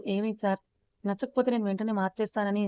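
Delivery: ordinary delivery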